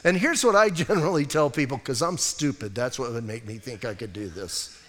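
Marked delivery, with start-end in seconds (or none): 1.8-4.8 low, soft throwaway admission; deadpan self-mockery. Answering the naysayers about himself, deadpan self-mockery. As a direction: drop it low and dry, trailing softer.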